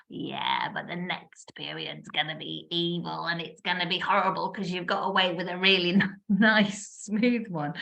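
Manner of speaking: low, hushed voice of her inner doubter; negative inner voice. Retold or imitated. To herself, her negative side warns of an evil period, low and hushed. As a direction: mutter the dark warning like a gloomy inner critic.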